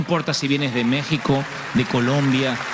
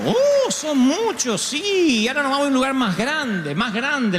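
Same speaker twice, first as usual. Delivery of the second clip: put-on old mother's voice; 11 semitones higher than usual. Mother replies on phone.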